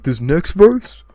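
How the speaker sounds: slow, loud, high rap parody dropping on the nonsense; drawn-out hype, then throwaway nonsense. Retold or imitated. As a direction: stretch it like a mock rap hook. Imitating [name]'s song lyric.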